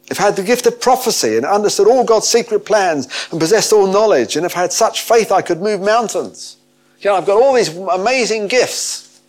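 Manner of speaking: high, loud, mounting excitement; grand build-up. Acted. Preacher listing prophecy, knowledge and faith, grandly excited. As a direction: pile up the wonders with soaring energy.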